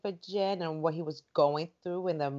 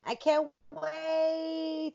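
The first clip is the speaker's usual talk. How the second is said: eager excitement; 8 semitones higher, much slower than usual. Real, not acted. Eager for next episode.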